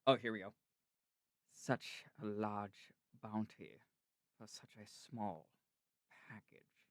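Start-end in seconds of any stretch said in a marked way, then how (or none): 1.5-6.9 slow, low, soft imitation with lilting pauses; measured narration, pausing between phrases; narrator impression. Shown as a demonstration. Showing a friend how he lilts and pauses, narrator impression. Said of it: "I could, like, replicate it".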